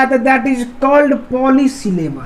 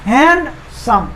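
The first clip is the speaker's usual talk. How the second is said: Handsome slowly, with a gap after hand; split into syllables; much slower than usual. Shown as a demonstration. Teacher showing it divides in two, split into syllables. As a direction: break handsome into two drawn-out beats. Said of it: "दो syllable".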